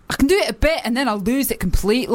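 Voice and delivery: ordinary talk, nothing marked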